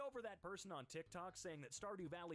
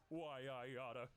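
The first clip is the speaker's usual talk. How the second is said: tough guy threat; 3 semitones lower than usual. Pretend.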